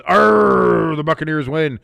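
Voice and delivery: lightly hammed pirate cheer. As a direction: read the chat line like a pirate. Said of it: "E L S in the chat says".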